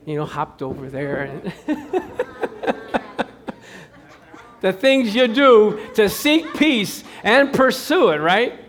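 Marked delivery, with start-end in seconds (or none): 1.6-3.2 unvoiced laugh. Real, not acted. Laughing at own story.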